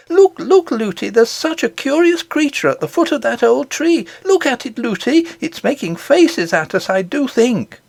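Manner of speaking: put-on high, eager child calling look; an excited little girl pointing things out. A narrator's reading. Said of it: "She was always saying".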